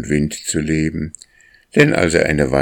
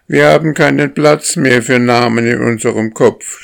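higher, loud whining complaint; goslings crying out; 7 semitones higher, 5 dB louder than usual. A narrator's reading. Overloaded goslings complaining to the lead goose, high and loud. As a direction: shout it up high like fed-up young geese. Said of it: "schrien die Jungen".

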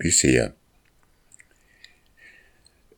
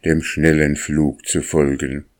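ordinary delivery

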